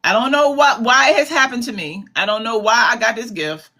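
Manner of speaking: ordinary talk, nothing marked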